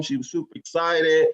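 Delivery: normal talking voice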